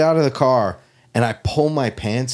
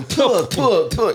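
high, repeated spitting puffs; comic spit sound effect; imitated spitting; 10 semitones higher, slower than usual. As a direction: mime spitting out a mouthful. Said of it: "yeah, I'm going".

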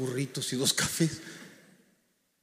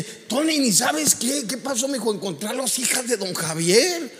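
higher, louder, bursting with good news; excited phone news; 6 semitones higher, 3 dB louder than usual. Retold or imitated. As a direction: blurt out the news you can't contain. Rafa phones with great news.

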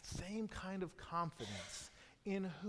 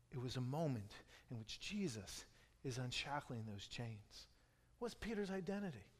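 ordinary talk

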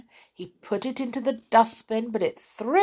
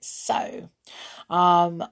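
ordinary delivery, nothing marked

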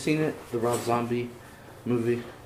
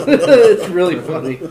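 laughing; 5 semitones higher, 10 dB louder than usual. Real, not acted. Laughing while telling.

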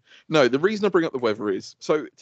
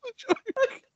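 high-pitched, laughing mock despair at the missed joke; laughing exasperation. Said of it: "I was like".

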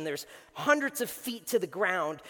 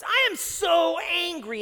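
angry Jonah; 10 semitones higher, 7 dB louder, slower than usual. Retold or imitated. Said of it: "And he says".

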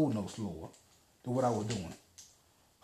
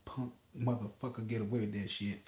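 ordinary talk, nothing marked

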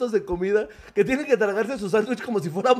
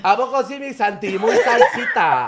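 mock fresa squeal; 2 semitones higher, slower than usual. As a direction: squeak it out slowly like a mocked fresa. Mocking a fresa.

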